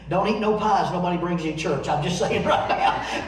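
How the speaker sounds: ordinary talk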